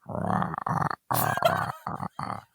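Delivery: low, unvoiced rasping rah ah ah; breathy grinding noise, fading away; imitated weird noise. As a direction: rasp out a dying mechanical groan. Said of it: "we hear this weird noise. It's going like".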